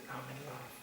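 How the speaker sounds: very soft retold admission; imitating a shy, quiet confession; hushed confession. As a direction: confess it under your breath. Boy confessing a secret, retold.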